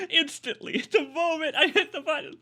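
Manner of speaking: amazed laughter; laughing. Real, not acted. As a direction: giggle out the words in disbelief. Laughing at the sudden scene.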